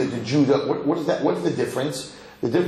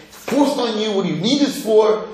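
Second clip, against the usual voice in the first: higher-pitched mock complaint; mock resentment; 7 semitones higher, 4 dB louder than usual. Teacher voicing a worker in religious homes, mock resentment. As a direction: push back like someone fed up.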